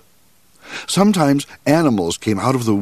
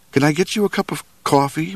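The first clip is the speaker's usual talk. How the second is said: higher and quicker, fussing to please; a nervous host rushing to offer; eager little cook; 7 semitones higher, faster than usual. Acted. Cook offering his strange guest coffee, higher and quick.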